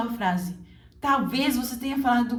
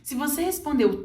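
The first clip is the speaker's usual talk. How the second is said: ordinary delivery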